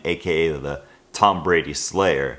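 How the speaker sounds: ordinary talk, nothing marked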